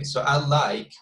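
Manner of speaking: usual speaking voice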